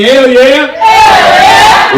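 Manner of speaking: shouted hell yeah to fire up the crowd; hype shout. Acted. Host hyping the crowd before the comedian, big shout. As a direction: belt it out like a hype man. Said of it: "let me say".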